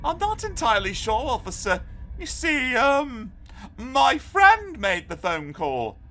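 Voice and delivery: high, hesitant, innocent fib; fibbing boy. A narrator's reading. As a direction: act innocent while stalling. Arthur fibs to the officer.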